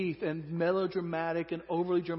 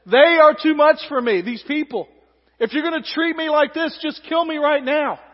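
loud, desperate outcry to God; melodramatic despair; 7 semitones higher, 10 dB louder than usual. Acted. Moses crying out to God to take his life, loud and melodramatic. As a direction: overdo the anguish at full volume. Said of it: "Melodramatic again".

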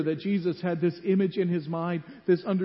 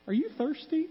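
wife's line; 9 semitones higher than usual. Retold or imitated. Preacher voices wife in story.

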